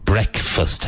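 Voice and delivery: "Breakfast" said as "brek-fust", short E; "Breakfast" with a short, unstressed second half; brekfast. Shown as a demonstration. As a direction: run "Breakfast" together as "brekfust". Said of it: "always pronounced break breakfast".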